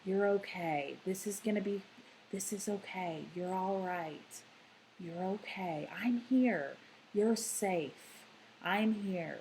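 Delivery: soft, gentle, simple comforting words; soothing reassurance. Shown as a demonstration. Parent soothing a dysregulated child, soft and reassuring. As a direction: hush and reassure an upset child, softly. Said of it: "maybe use some language, but very minimal language".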